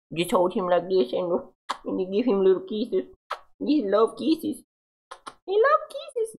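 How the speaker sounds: high, soft cooing over kisses. Real, not acted. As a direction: coo softly and high as you cuddle a cat. Kissing and cooing over cat.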